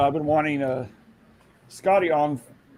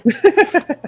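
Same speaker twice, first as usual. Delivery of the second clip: loud laugh; 14 semitones higher, 11 dB louder than usual. Real, not acted. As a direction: burst of high laughter. Laughing at Al Pacino joke.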